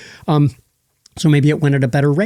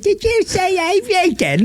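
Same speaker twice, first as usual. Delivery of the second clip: much higher squeaky mock British voice; botched accent. Host showing how he botched the accent. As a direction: squeak it like a cheeky little monkey. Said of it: "I totally botched the accent".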